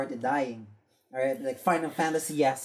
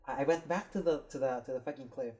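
ordinary delivery

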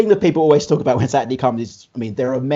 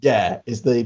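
ordinary delivery

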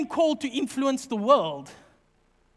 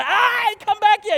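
high, loud yell while chasing; running yell; 9 semitones higher, 7 dB louder than usual. Retold or imitated. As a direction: shout at the fleeing thieves. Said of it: "runs like this".

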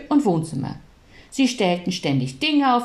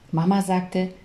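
usual speaking voice

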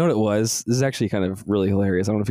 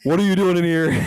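higher, louder demanding guard voice; annoyed guard; 9 semitones higher, 4 dB louder than usual. Retold or imitated. Quoting the planetarium guy, higher sharp challenge. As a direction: bark it like a startled guard. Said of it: "this guy comes in and he's like".

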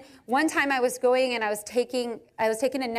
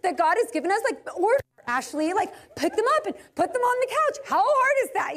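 high mock-annoyed imitation, much higher; husband's complaint; 7 semitones higher than usual. Wife imitating husband complaining about pillows, mock-annoyed. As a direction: ham up his exasperation. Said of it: "And he's like".

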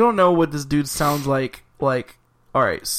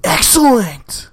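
high, loud, stretched surfer cheer; exaggerated surfer-bro exclamation; Bill and Ted; 8 semitones higher, 5 dB louder, slower than usual. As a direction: draw it out like an elated dude. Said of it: "what's the Bill and Ted's accent?".

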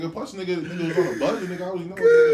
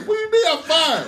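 high, loud mock protest; comic outraged question, voice shooting up; retold indignant disbelief; 12 semitones higher, 7 dB louder than usual. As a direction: cry it out as if just fired. Retold firing, protesting employee.

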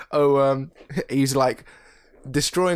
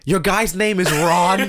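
slow, higher mock Bill Burr line; quoting Bill Burr; 6 semitones higher, 4 dB louder, much slower than usual. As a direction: drag it out like Bill Burr's bit. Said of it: "when Bill Burr was talking about that".

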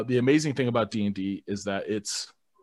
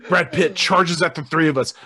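Dungeon Master voice; 4 semitones higher, 3 dB louder than usual. Acted. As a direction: set the scene like a storyteller. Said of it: "Let me get into my Dungeon Master voice".

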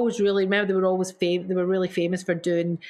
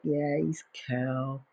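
low, soft and slow, mock unimpressed; a deliberately underplayed yeah, he's cool; playing it cool; 6 semitones lower, 7 dB quieter, much slower than usual. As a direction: shrug it off, lower and quieter. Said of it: "And I was like".

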